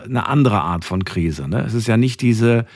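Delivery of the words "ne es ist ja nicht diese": usual speaking voice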